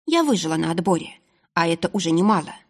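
ordinary delivery, nothing marked

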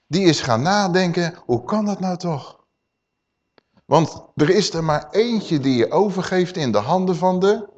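normal talking voice

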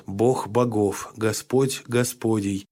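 soft chanted prayer; quiet recitative. A narrator's reading. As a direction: chant it low under breath. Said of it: "быстро и негромко речитативом".

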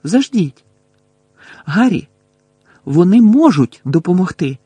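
high, loud, urgent realisation; sudden interjection. A narrator's reading. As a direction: break in eagerly with an idea. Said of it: "раптом обізвалася Герміона". (раптово, високо й наполегливо)

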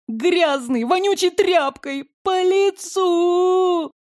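high, drawn-out whining wail; fake crying wail. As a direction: wail it like a crybaby. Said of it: "самые настоящие слёзы".

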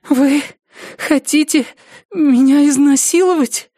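high, halting fearful question; frightened woman. Acted. Captive woman asks attacker his intent, halting high fear. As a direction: break it up with fearful pauses, pitched high.

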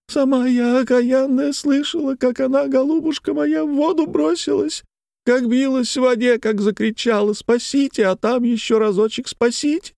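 very high wailing lament; keen through the tale as if weeping; high plaintive sing-out of grief; tearful woman. A narrator's reading. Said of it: "слезливым голосом женщина".

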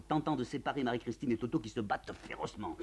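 ordinary delivery

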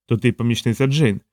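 ordinary delivery, nothing marked

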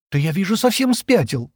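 high-pitched exasperated outburst; irritated exclamation. A narrator's reading. Джема reacts to Стэн's hijack plan, irritated. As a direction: exclaim in annoyed disbelief. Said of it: "раздражённо взмахнув руками".

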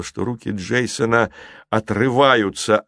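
usual speaking voice

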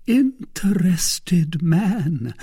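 high, very slow teasing drawl; smiling reproach. A narrator's reading. Lady half resisting her suitor, smiling, drawn-out reproach. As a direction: scold him through a smile, stretching the words.

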